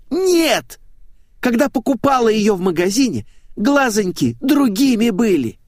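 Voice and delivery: put-on high girlish insistence; insistent blonde. A narrator's reading. Blonde contradicts the narrator about her sticker, insistent.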